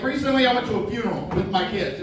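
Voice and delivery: ordinary talk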